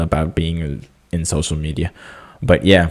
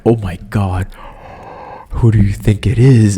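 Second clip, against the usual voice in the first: a louder, eager "who do you think it is?"; excited wondering; 3 semitones higher, 3 dB louder than usual. Retold or imitated. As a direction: blurt it out in excitement. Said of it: "talking to myself".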